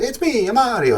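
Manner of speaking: high, bouncy mock Italian "It's me, I'm Mario"; Italian Mario impression. Reviewer imitating the movie commercial's Italian accent. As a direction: chirp it like the game's Italian plumber. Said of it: "They do that thing".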